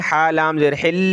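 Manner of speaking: ordinary delivery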